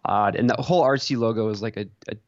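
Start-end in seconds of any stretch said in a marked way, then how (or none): none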